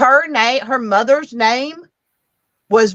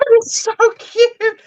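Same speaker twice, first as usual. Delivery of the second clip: laughing; 13 semitones higher, slower than usual. Real, not acted. Laughing defends the book.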